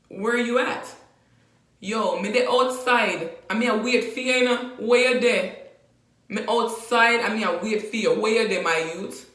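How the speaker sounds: calling a friend from outside, Jamaican style; Jamaican patois phone call. Shown as a demonstration. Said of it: "patois phone call demo".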